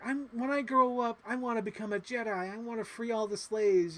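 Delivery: a hint of a hopeful kid; little Anakin. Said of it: "he's just like".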